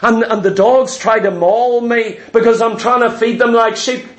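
ordinary delivery

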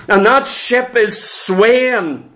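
ordinary delivery

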